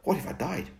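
sharp, high angry question; snapping. Acted. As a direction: snap it back at him. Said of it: "I snapped".

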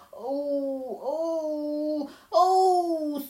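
hammed-up wailing sobs, rising; sobbing wail. A narrator's reading. Faun weeps to Lucy, acted sobbing. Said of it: "sobbed Mister Tumnus".

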